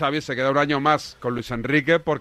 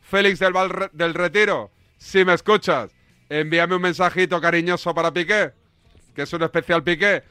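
higher-pitched, projected radio link; radio presenter announcement; 4 semitones higher than usual. Real, not acted. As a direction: announce the segment and phone number to listeners. (locución de radio, tono alto y proyectado)

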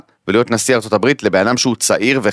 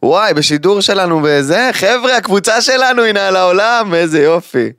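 excited; 9 semitones higher than usual. Real, not acted. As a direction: cheer it like great news. Excited about live push. (בהתלהבות)

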